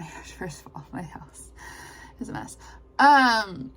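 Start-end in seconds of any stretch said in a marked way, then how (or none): 0.0-2.6 hushed confiding whisper. Real, not acted. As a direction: drop to a whisper. Confiding aside about messy house.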